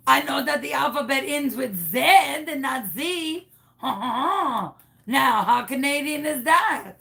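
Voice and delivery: triumphant hammed-up French-Canadian lilt; fake Canadian accent. Caller proving she says zed, fake Canadian accent. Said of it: "What happened to your accent?".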